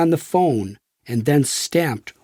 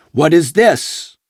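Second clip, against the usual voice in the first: challenging; 3 semitones higher, 6 dB louder than usual. A narrator's reading. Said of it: "an agent challenged".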